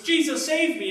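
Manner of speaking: high, loud panicked cry for help. Acted. Peter sinking in the storm cries out to Jesus, loud and panicked. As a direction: yell it like you're sinking and need saving. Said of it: "he cried out to Jesus".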